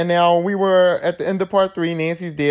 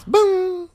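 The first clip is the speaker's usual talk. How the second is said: mock boom. Dramatic movie reveal.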